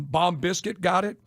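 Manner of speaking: usual speaking voice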